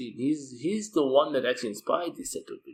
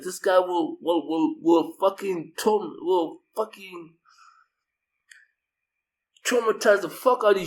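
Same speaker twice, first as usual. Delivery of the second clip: ordinary delivery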